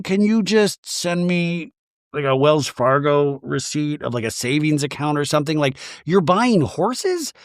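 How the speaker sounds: ordinary delivery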